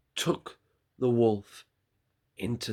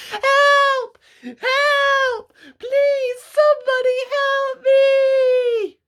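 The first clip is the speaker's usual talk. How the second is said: very high, much louder, panicked shriek; screaming for help; 13 dB louder, much slower than usual. Acted. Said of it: "could do nothing but scream".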